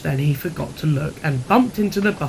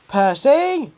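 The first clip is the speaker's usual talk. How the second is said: calling out; 10 semitones higher, 3 dB louder than usual. Acted. Thomas calls to Percy.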